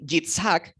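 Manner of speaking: "Yitzhak" drawn out slowly, ts in the middle, hard final k; Hebrew pronunciation. Shown as a demonstration. Teacher showing Isaac's real name, "Yitzhak" in slow Hebrew pronunciation. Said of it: "que es realmente el nombre de".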